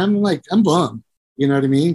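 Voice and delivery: ordinary talk, nothing marked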